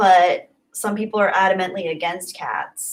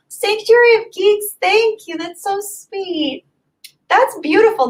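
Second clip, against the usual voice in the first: much higher, gushing thanks; touched and thrilled; 11 semitones higher than usual. Real, not acted. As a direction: gush with delight over the gift. Moved by sweet message.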